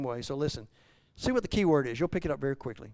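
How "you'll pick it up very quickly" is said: ordinary talk, nothing marked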